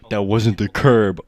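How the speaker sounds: imitating Aldo. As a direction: ham up Aldo's punchline slowly. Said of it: "love the face of Aldo when he tells the story".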